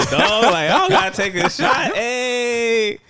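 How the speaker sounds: loud, high-pitched retold boast; mock triumph. Kid thinks he dodged the shot, loud mock triumph.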